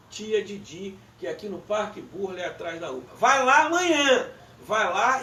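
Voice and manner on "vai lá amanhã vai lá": high, loud, drawn-out urging; emphatic loud challenge. Real, not acted. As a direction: dare them loudly, stretching the words out. Challenges listeners to go and check.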